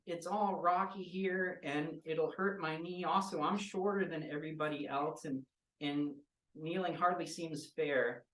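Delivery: put-on a short kid worrying about her knee; childlike pleading voice; small girl character. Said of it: "And then Maisie goes".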